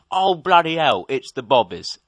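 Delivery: loud, mock English accent; Nicolas Cage. Reviewer imitating film star fleeing British police, loud fake English accent. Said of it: "Nicolas Cage basically goes".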